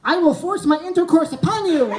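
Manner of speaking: the nervous man tries to talk dirty back, stilted declaration; stiff fake dom. Awkwardly trying to sound dominant, loud and stilted. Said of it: "So I was like".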